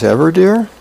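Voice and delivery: questioning. Acted. Wife answering husband in bed, questioning. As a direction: echo it back as a doubtful question.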